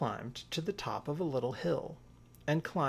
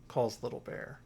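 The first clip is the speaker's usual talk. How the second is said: usual speaking voice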